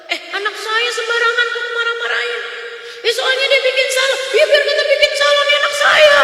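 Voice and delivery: high, loud, indignant mother protesting. Retold or imitated. Preacher imitating a mother defending her child, high and loud. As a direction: play the offended mother snapping back at critics.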